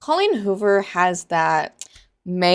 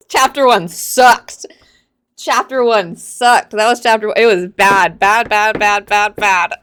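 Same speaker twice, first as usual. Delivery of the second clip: louder, drawn-out "Chapter one sucked"; loud rant; 2 semitones higher, 9 dB louder than usual. Real, not acted. Book reviewer to viewers, loudly ranting about chapter one. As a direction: hammer every "bad" loudly.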